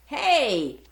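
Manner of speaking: sudden loud shout; loud call. Acted. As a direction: call out loudly to wake her. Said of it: "a loud voice said".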